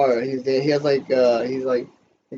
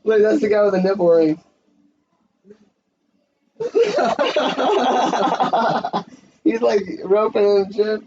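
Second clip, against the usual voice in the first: giggly commentary; 8 semitones higher, 4 dB louder than usual. Real, not acted. Laughing through watching the scene.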